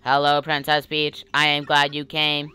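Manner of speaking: even monotone computer. Acted. Tec greeting Princess Peach, monotone computer voice. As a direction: read it evenly, like a machine. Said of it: "I'll do the voice".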